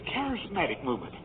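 mock preacher, quiet. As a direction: say it under your breath as another preacher would. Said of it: "a preacher gets up and he says".

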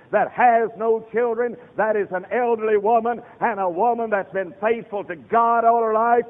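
loud, high hellfire sermon voice; fiery preaching. Acted. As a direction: thunder it at the congregation. Preaching at full force.

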